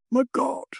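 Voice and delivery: high, startled exclamation; breathed exclamation. A narrator's reading. Quinn watching the lamps tremble, high awed exclamation. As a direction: gasp it out in awed shock, pitched high. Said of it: "breathed Quinn".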